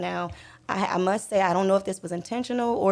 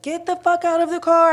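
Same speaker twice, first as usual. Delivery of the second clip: high, loud imitation of a barked command; retold shouted order; 10 semitones higher, 8 dB louder than usual. Officer ordering a driver out at gunpoint, imitated shout. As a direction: shout it as the officer did, sudden and forceful.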